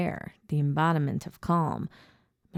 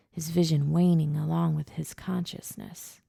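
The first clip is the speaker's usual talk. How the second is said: plain reading voice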